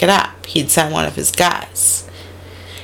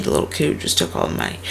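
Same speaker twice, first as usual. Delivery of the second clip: whisper; 13 semitones lower, 8 dB quieter, much faster than usual. Retold or imitated. Juice repeating incredulous.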